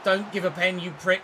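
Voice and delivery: loud irritated snap at the defender; annoyed. Real, not acted. Gamer warning his defender about a penalty, annoyed. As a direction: bark it with rising annoyance. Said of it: "I'm getting annoyed now, can you tell?".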